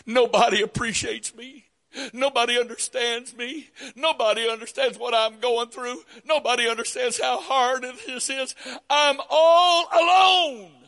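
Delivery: high whiny martyr voice; self-pitying whine. Retold or imitated. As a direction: wail about being all alone. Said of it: "the real kicker".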